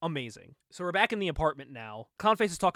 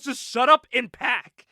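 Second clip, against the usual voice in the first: loud, raised-pitch snapped command; retold angry order; 6 semitones higher, 9 dB louder than usual. Father ordering his daughter to pack, angry order. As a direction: cut the argument off loudly.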